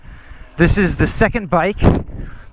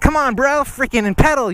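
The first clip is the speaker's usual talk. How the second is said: high, excited egging-on; playful high call to a tandem partner; mock urging; 8 semitones higher than usual. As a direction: cheer your partner on to pedal, in jest. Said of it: "I'm like".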